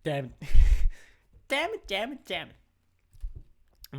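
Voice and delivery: loud frustrated cursing with laugh. Real, not acted. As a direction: curse the play, half laughing. Caster frustrated at play.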